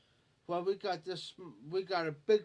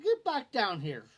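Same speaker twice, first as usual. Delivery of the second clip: loud, high call up the hill; retold yell at a runaway friend; 6 semitones higher, 8 dB louder than usual. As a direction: holler him back down the slope.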